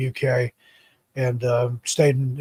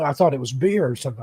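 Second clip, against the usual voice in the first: normal talking voice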